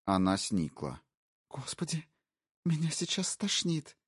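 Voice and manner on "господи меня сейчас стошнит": higher voice, soft and revolted; disgusted young woman. Acted. Wife recoiling from the intruder, higher and disgusted. Colette's voice.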